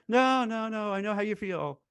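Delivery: high, dismissive "no, no, no"; mock soothing HR. Recruiter voicing HR brushing off a fired worker, mock soothing. HR replies in acted skit.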